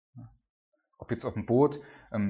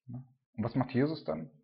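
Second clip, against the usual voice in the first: usual speaking voice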